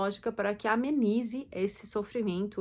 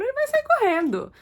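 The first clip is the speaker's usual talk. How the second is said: high, loud yelp of a phrase; mock shout; 13 semitones higher, 6 dB louder than usual. Teacher showing how shouting scares a dog, high mock shout.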